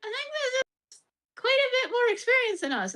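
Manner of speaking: put-on small nervous girl voice; worried T-doll. Voicing Khalid.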